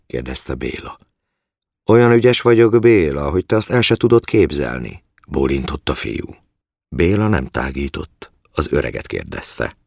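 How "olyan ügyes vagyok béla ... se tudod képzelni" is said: high, loud comeback easing off; cocky, raised-voice retort; defiant retort. A narrator's reading. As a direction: throw the taunt right back, pitched up. Boy throws Béla's taunt back.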